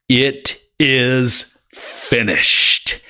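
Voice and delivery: very slow, weighty delivery; solemn slow quote. Acted. Preacher voicing Jesus' final words, very slow and solemn. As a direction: let each word land slowly.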